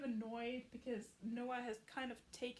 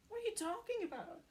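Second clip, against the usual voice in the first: much higher, puzzled question; genuinely lost; baffled; 8 semitones higher than usual. Retold or imitated. Nick baffled that Noah wants out, puzzled.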